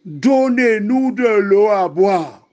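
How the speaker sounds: high, drawn-out demand; slow, insistent outcry of a thirsty people; demanding crowd. Acted. People quarrel with Moses, read aloud.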